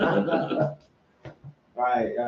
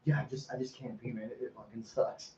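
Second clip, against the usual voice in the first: mumbled complaint; 3 semitones lower, 16 dB quieter, faster than usual. Retold or imitated. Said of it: "then goes".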